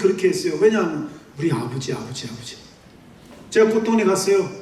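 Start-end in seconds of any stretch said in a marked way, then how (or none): 1.4-2.6 whisper-close yearning for father; soft longing call. Retold or imitated. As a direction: lower and much softer, calling father. Calling out to his father.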